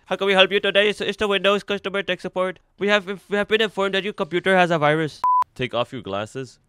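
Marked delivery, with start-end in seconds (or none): 0.0-5.2 lightly voiced Indian tech-support accent; a hint of an Indian call-centre agent; mock Indian accent. Streamer playing a scam tech-support caller, light Indian accent. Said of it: "I'm from India".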